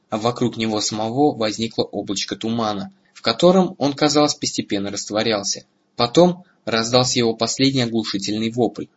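usual speaking voice

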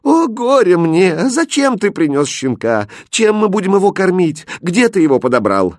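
lament, then fire off upset questions; distressed cry. A narrator's reading. Poor mother seeing the puppy her son brought, crying out. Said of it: "бедная женщина закричала".

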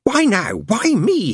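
Crowley curses alone in the car, panicked mutter. A narrator's reading. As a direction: curse under the breath then plead. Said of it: "he muttered".

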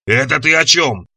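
slow monster growl. A narrator's reading. Monster questions the captured party, growling slowly. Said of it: "прорычало чудовище".

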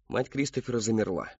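ordinary delivery, nothing marked

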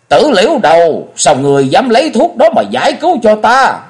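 furious, high-pitched yelling; high-voiced shout. A narrator's reading. Revived mistress berates the girl who saved her, shouting furiously. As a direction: scream the rebuke, loud and very high. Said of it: "đồng thời cao giọng hét lên".

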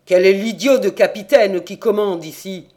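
furious demand, loud and sharp; angry king. A narrator's reading. Angry king demanding the captain at his quay, loud. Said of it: "Le roi était très en colère".

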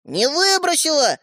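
high, drawn-out objection; desperate protest. A narrator's reading. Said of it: "задыхаясь от отчаяния". (с отчаянием)

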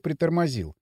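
usual speaking voice

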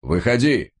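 короткий высокий приказ; рявкни приказ; громкий окрик. A narrator's reading. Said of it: "крикнул майор". (резкий окрик)

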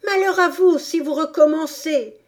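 high, loud furious warning; terrible voice. A narrator's reading. Alice warning her attackers, furious shout. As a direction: bellow the threat at them. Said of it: "d'une voix terrible".

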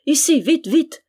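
high, loud summons; excited, hurried calling; urgent call. A narrator's reading. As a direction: order the maid here at once. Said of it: "appela-t-elle".

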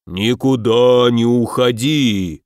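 slow, heavy monster bark; barking demon. A narrator's reading. Right demon forbidding escape, a slow bark. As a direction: roar out the order like a demon. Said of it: "рявкнул правый".

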